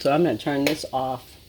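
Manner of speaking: ordinary talk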